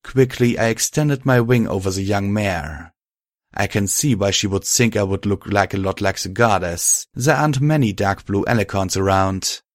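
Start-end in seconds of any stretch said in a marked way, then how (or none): none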